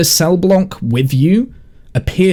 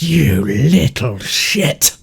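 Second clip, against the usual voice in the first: snarled insult; much slower than usual. Acted. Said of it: "she just, like, goes".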